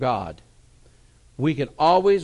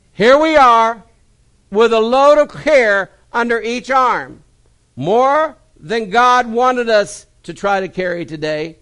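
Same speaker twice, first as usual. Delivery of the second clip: louder, raised preacher voice; preaching; 10 semitones higher, 14 dB louder, slower than usual. Acted. Pastor applying the story to congregation, preaching. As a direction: press the point home to the church.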